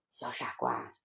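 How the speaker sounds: soft, low motherly voice; fond chiding. Acted. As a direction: gently tease your silly child. Mother fondly chides her children. (妈妈低声温柔地嗔怪)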